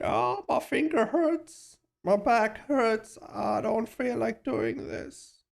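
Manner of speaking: put-on high, slow, complaining old lady voice; whiny old grandma. Streamer mocks friend Yuning as a grandma, high slow whiny voice. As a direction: moan about aches like a frail granny.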